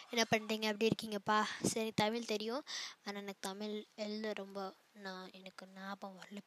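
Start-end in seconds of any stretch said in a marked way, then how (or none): 5.1-6.4 hushed whisper, almost breath only; embarrassed whisper. Real, not acted. Whispering she cannot remember.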